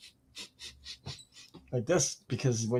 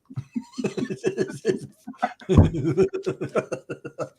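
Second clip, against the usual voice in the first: long high laugh at own joke; big laugh; 7 semitones higher than usual. Real, not acted.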